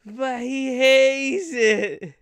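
loud, very slow hammed-up moan; mock lament. Moaning about the cursed ghost, hammed-up slow lament. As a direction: draw every word out in fake sorrow. Mock grief over a hated fate.

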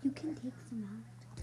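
whisper. Real, not acted. Sibling whispers suggestion.

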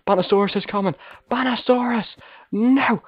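high alarmed cries of the name. Real, not acted. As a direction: warn in sudden fear. Enemy approaching, alarmed calls.

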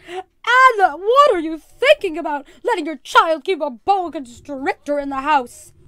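loud, high, scandalized scolding; carrying outraged voice. A narrator's reading. Missus Perkins scolds her friend over the snake, loudly. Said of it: "Missus Perkins' voice carried over the noise".

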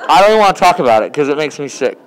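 laughing ew reaction; grossed out. Real, not acted. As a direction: rush it out, squirming. Grossed out by parents.

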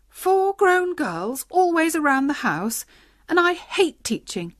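wife groaning at four stepdaughters; dismayed lament. Acted. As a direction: bemoan the burden. Dismayed wife laments her fate.

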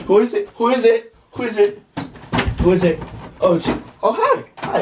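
high, jumpy fake innocence; mock nervous greeting. To friends walking in on him, mock nervous greeting. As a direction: call out high, acting as if nothing's up.

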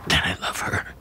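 faint breathy last words; dying whisper. Acted.

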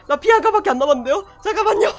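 beg, rushing the words; panicked polite plea. Real, not acted. Repeats louder, higher, polite.

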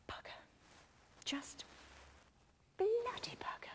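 hushed curse breathed off the phone; whispered curse. Acted. Mo cursing under her breath while Mister Adams is on hold, whispered curse. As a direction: whisper the swear so the caller can't hear.

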